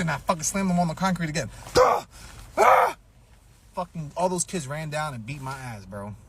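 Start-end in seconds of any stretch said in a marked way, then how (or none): 1.7-3.0 very high, loud effort yell; slam grunt and yell. Retold or imitated. Kid slamming the bully onto concrete, effortful yell. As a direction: shout as you slam him down.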